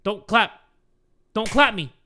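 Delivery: usual speaking voice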